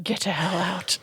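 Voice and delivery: unvoiced whispered echo; a whispered aside playing the grumpy turtle. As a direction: breathe it out in a whisper.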